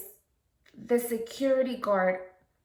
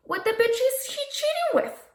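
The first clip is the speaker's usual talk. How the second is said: high-pitched incredulity. Retold or imitated. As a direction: voice leaps into a very high register. Exasperated punchline about the cheating couple.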